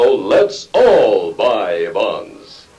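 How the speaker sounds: ordinary delivery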